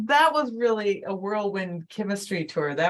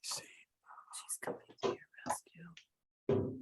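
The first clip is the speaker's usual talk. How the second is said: soft unvoiced aside; whispering; 13 dB quieter, slower than usual. Real, not acted. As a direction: whisper it under your breath. Aside while another speaker is on.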